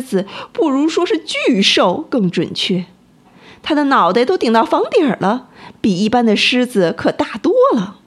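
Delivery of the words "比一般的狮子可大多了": ordinary delivery, nothing marked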